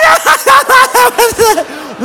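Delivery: loud, high imitation cackling; mock laughing fit, running out of breath; hysterical laughter. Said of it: "she can't breathe. She's shaking like this".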